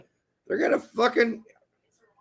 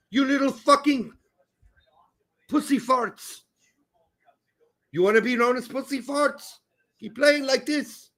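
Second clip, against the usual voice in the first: mock coach rant; 6 semitones higher than usual. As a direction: chew out the team like an angry coach. Acted coach halftime rant.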